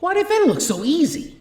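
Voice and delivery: put-on high, loud panicked boy's voice; a rattled kid who can't believe it; frantic thought. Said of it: "thought to himself frantically".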